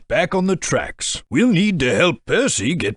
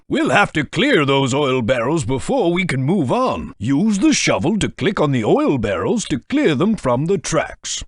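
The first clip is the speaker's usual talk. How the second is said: ordinary talk, nothing marked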